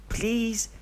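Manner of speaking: very high pleading please; a small kid begging; begging child. Acted. Deidara begging Obito from behind, very high child voice.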